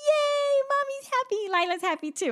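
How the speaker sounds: put-on very high cheering child voice; imitating happy toddler. Mom retells daughter cheering that mommy is happy, very high child voice. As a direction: squeal it like a delighted little kid.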